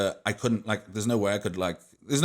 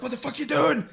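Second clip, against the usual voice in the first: much higher, louder outraged yell; mock the brother's fury, pitched way up; imitating angry brother; 5 dB louder than usual. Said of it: "he's just getting more and more angry".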